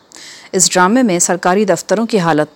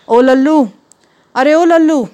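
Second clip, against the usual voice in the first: calling out; 7 semitones higher, slower than usual. Acted. Wife calling the servant.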